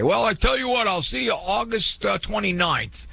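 high-pitched imitation of a casual put-off; mock breezy brush-off. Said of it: "I'm not gonna say".

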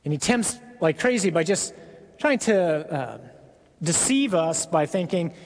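ordinary talk, nothing marked

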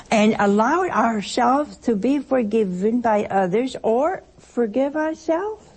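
ordinary delivery

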